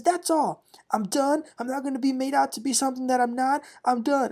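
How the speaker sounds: high, quick mock-exasperated refusal; fed up. [name] before she storms off, mock fed up.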